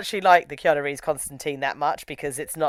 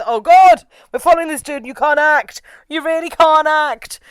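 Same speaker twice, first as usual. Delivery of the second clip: higher, exasperated mock complaint; mock exasperation; 9 semitones higher, 6 dB louder than usual. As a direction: groan at bad acting. Mocking exasperated viewer.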